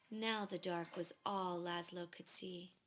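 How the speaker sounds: ordinary delivery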